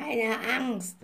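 soothing raven voice; reassuring raven. A narrator's reading. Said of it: "warf der Rabe beruhigend ein". (beruhigende Rabenstimme)

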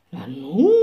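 a surprised little witch calling out. A narrator's reading. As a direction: witch shaking her head, surprised. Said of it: "rief sie kopfschüttelnd".

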